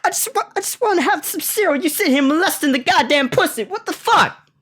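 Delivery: very high squeaky voice, half laughing; a whiny falsetto rant; exasperated whine. Retold or imitated. Owner ranting at the dog humping the cat, in a squeaky laughing whine. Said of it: "I'm sitting here like".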